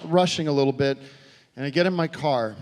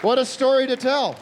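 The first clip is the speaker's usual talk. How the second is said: excited; 8 semitones higher, 5 dB louder than usual. Acted. First take, excited.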